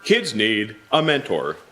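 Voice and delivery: ordinary talk, nothing marked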